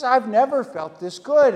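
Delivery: high, loud burst of joy; hammed-up thrilled old man; overjoyed. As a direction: exclaim with delight.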